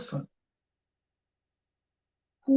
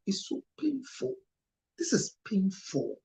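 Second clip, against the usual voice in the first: pained; 6 semitones higher, 6 dB quieter than usual. Real, not acted. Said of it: "I'm getting very emotional".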